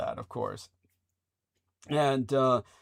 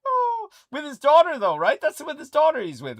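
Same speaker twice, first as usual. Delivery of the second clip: high teasing voice; 13 semitones higher, 6 dB louder than usual. Pretend.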